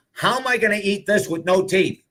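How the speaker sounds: high, squeaky mock voice of a toothless man joking; quoting a toothless friend's joke about crackers, in a high amused voice. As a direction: play him as tickled by his own joke, voice up high.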